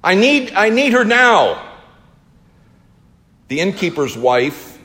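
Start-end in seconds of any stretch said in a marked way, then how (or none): 0.0-1.6 high, urgent shout; loud, panicked plea pitched high; yelling for help. Acted. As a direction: cry out desperately for a midwife. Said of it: "I yelled".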